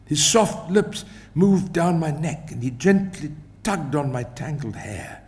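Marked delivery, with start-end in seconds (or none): none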